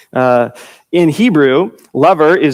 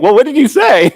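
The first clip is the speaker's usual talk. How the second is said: lightly pitched-up, prodding question; curious friend; 8 semitones higher than usual. Retold or imitated. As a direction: ask him what he said, half amused. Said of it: "And you're like".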